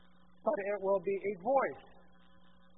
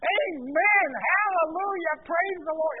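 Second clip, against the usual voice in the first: loud, high church exclamations; joyful praise shout; 9 semitones higher, 13 dB louder, slower than usual. Acted. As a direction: shout it out like a happy congregation. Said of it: "what would you say".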